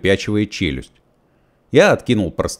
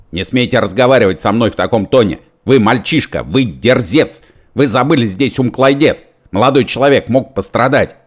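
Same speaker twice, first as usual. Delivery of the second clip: loud, higher indignant tirade; outraged scolding; 5 semitones higher, 5 dB louder than usual. A narrator's reading. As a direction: scold the rude youngster. Fat man berates Korneev. (возмущённая отповедь, громко)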